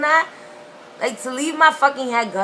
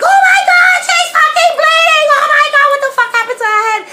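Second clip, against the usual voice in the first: much higher, louder hysterical shrieking; dramatic hysterical relatives; 12 dB louder than usual. Retold or imitated. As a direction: shriek it like panicked family. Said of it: "everybody's like".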